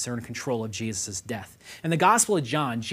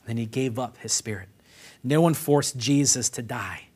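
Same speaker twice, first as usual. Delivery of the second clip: ordinary delivery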